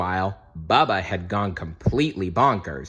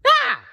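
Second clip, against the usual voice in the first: sudden piercing high yelp, louder; 6 dB louder than usual. A narrator's reading. Larry yelps as his nose sticks to the table. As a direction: squeal in sudden alarm. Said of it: "Larry yelped".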